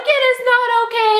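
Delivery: high-pitched, wailing mock outburst; hammed-up distraught yell; hysterical yelling. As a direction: re-enact a tearful meltdown, way up high. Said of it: "then I start kind of yelling".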